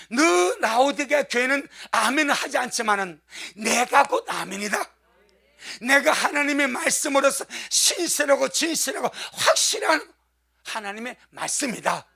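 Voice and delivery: loud, emphatic divine declaration; forceful preaching. Acted. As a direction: proclaim it with full pulpit force.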